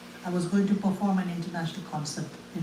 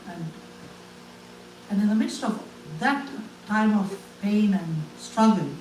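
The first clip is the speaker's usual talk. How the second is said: usual speaking voice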